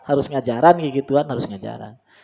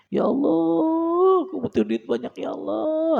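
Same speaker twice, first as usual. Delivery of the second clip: much higher, slow pleading whine; whiny begging prayer. Shown as a demonstration. Preacher mocking a needy prayer, high pleading whine. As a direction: beg God in a drawn-out whine. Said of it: "Ah, itu kurang keren itu".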